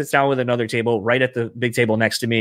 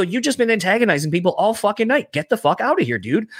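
high-pitched mock telling-off, volume held back; retold yelling; 7 semitones higher than usual. Bouncer ordering out a man who antagonized people, retold yelling. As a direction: retell an exasperated rebuke. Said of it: "yelling at this dude".

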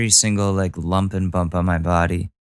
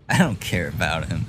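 laughing; 4 semitones lower, 5 dB quieter than usual. Real, not acted. Repeats line laughing.

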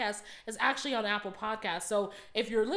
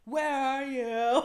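mock emo wail; 4 semitones higher, 4 dB louder, much slower than usual. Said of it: "mocking emo lyrics".

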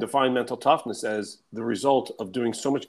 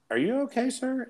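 concerned woman operator; 13 semitones higher than usual. Retold or imitated. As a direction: imitate a puzzled operator, pitched way up. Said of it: "she's like".

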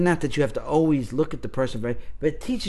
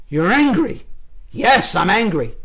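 anger starting to rise; acting exercise repeat, getting firmer and higher; building anger; 4 semitones higher, slower than usual. Shown as a demonstration. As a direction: push it up a notch, irritated. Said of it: "it always would start out something like this".

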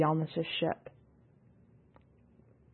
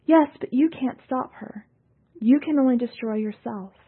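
higher, pressing warning; urgent; 6 semitones higher, 4 dB louder than usual. A narrator's reading. Yalnis warning Tasman, higher and urgent. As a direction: press him to see sense. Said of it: "To Tasman she said".

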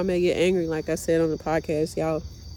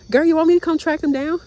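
sassy best friend; 9 semitones higher, 6 dB louder than usual. Retold or imitated. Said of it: "And she was like".

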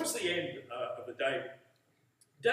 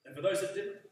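earnest, louder public prayer; 4 dB louder than usual. Acted. Pastor leads closing prayer.

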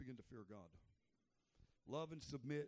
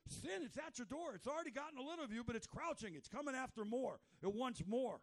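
higher urgent warning voice; God gently warning Cain; voicing God; 9 semitones higher, 7 dB louder than usual. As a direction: warn him like a caring God. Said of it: "God's like".

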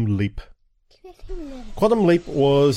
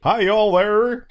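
high, drawn-out American southern greeting; American accent; 9 semitones higher, 3 dB louder, slower than usual. Shown as a demonstration. Parent demonstrates American accent for the children, exaggerated. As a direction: stretch out a put-on American hello. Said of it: "Can you do an American accent".

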